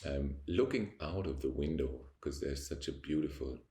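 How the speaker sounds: ordinary delivery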